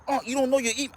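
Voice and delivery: can't believe what he's hearing; incredulous. Retold or imitated. Nephew to uncle who can't set up PlayStation, incredulous high-pitched disbelief.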